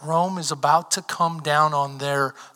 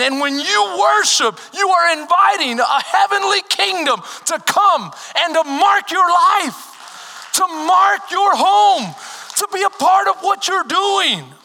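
preaching, building higher and louder; fiery preaching; 8 semitones higher, 6 dB louder than usual. Acted. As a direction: lift the room, build to a shout. Preaching at full force, rising.

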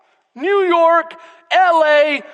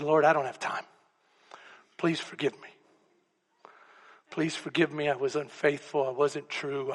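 quiet plea; 10 semitones lower, 11 dB quieter than usual. Retold or imitated. Pleading prayer to God.

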